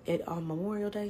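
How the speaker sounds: ordinary talk, nothing marked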